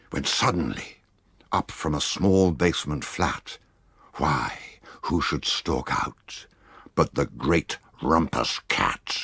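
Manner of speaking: ordinary delivery, nothing marked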